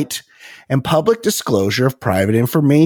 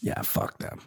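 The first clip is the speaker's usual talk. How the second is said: whispered curse; 16 dB quieter than usual. Real, not acted.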